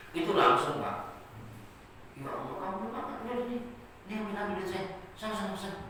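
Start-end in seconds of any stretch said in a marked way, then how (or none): 2.2-5.8 high, softer, pressing money on him; hurried kind giving, pitched up; generous Ali. Acted. Ali pushing his money on the hungry beggar, high and kind.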